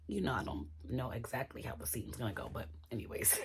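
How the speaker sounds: soft whispered aside. Real, not acted. Vlogger whispers to viewers before pressing play, whisper. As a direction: whisper it as if sharing a secret.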